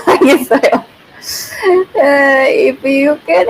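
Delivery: real chuckle into the reply; amused, laughing acknowledgement. Real, not acted. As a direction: answer through a laugh. Laughs while answering the chat.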